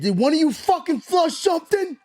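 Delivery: dad yelling at his sons about the toilet, shouting; retold shout at kids. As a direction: demand the truth at a yell.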